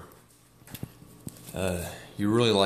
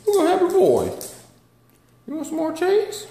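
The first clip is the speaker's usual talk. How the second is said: much higher, louder cooing; 3 dB louder than usual. Real, not acted. Baby talk to the dog.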